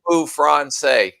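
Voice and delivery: high, loud French phrase. Shown as a demonstration. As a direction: belt out "Parlez-vous français?" playfully.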